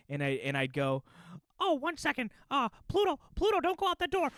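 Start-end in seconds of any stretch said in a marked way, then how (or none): 1.6-4.3 high squeaky mouse, distracted by the dog; Mickey. Mouse on the game mic warns his dog, Mickey voice. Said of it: "I'm on the mic as Mickey".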